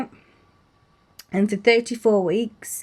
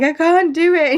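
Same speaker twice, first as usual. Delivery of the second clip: much higher and louder, breaking into tears; blubbering; 9 semitones higher, 5 dB louder than usual. Retold or imitated. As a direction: sob the words out, overwhelmed. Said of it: "I was like a blubbering mess".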